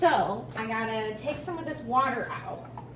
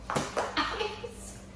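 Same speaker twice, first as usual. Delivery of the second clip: laughing with joy; 11 semitones higher, 8 dB quieter than usual. Real, not acted. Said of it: "I am so happy".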